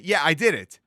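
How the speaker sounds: loud self-satisfied boast; a doer boasting loudly about the mitzvah; proud boast. Retold or imitated. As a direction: pat yourself on the back out loud. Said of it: "they say to himself".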